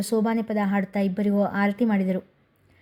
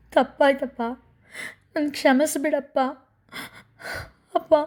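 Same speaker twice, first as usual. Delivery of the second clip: higher, tearful plea for forgiveness; sobbing apology; 5 semitones higher than usual. A narrator's reading. Daughter at her father's feet, begging forgiveness in sobs. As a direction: beg forgiveness through sobs. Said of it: "ಬಿಕ್ಕಿ ಬಿಕ್ಕಿ ಅಳತೊಡಗಿದಳು".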